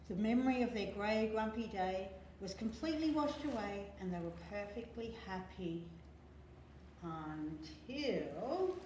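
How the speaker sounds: ordinary delivery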